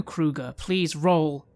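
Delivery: ordinary talk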